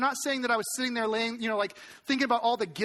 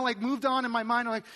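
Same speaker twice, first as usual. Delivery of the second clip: ordinary delivery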